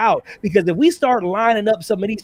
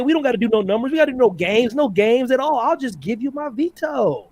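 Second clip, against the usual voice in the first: higher-pitched impression of an easygoing man; put-on higher voice, generously waving it off; Dylan Matthews; 3 semitones higher than usual. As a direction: mimic him sing-song, slowing at the end. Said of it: "that is the best Dylan Matthews impression".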